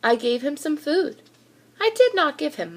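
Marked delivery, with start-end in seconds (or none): none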